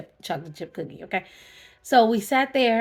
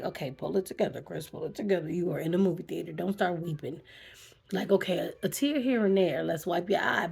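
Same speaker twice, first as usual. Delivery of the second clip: softer, hushed, firm pep talk to herself; quiet self-talk; 4 semitones lower, 8 dB quieter than usual. Retold or imitated. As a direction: talk yourself down under your breath in the theater. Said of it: "I was like".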